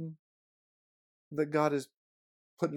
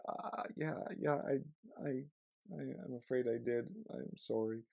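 sheepish apology; 6 dB quieter than usual. Retold or imitated. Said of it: "I says".

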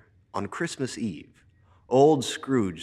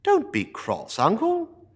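high, loud, gentle coaxing; coaxing nephew; 13 semitones higher, 6 dB louder than usual. Acted. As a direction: soothe a grumpy uncle. Nephew pleads.

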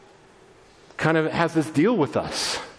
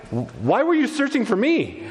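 higher-pitched puzzled question; confused; 7 semitones higher than usual. Acted. Boy Jesus answering his worried parents, confused. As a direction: ask as if honestly baffled. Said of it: "He's confused".

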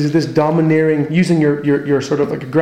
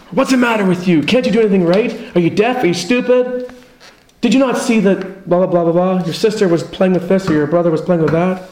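domineering voice; 4 semitones higher than usual. Retold or imitated. A domineering dad belittling his child, mock berating. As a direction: tear into the kid like a bullying dad. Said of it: "this domineering, um, voice".

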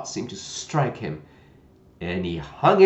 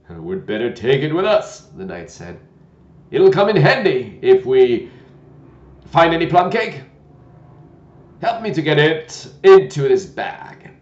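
put-on loud, gentle old knight; a kindly, bumbling old knight; 4 semitones higher, 10 dB louder than usual. Knight voiced in story.

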